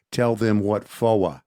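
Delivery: slow Southern drawl, FOR stretched to "fo-uh"; fake movie Texas accent. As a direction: imitate a bad Hollywood Texan, dropping the R in FOR. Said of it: "they have the character say".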